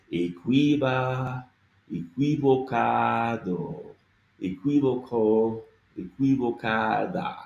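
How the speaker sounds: equivocado drawn out very slowly, each syllable sounded. Shown as a demonstration. Teacher sounding out equivocado slowly for learners. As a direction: work through equivocado one syllable at a time. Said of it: "we have to work through the whole word".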